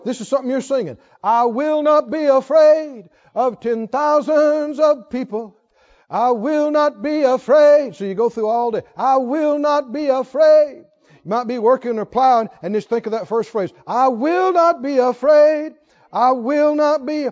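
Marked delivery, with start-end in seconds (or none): 1.2-7.9 bold, slow psalm declaration. Acted. Psalm declared boldly as song. 8.9-10.8 bold, slow psalm declaration. Acted. Psalm phrase repeated all day. 13.8-17.3 slow, loud, resolute vow; bold declaration. Acted. Bold psalm declaration before timid version.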